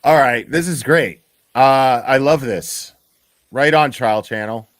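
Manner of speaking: loud delighted outburst; thrilled and loud; excited. Real, not acted. Streamer delighted by the clip.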